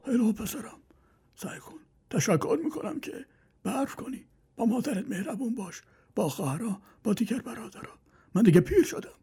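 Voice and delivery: put-on high, soft old man praising; old father praises his son quietly; approving old father.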